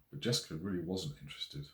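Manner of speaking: ordinary delivery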